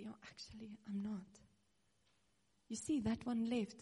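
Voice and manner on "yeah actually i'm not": whispered, resigned self-talk; hushed inner reply. Retold or imitated. Whispering back to the accusing voice, resigned. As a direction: breathe it like a private admission.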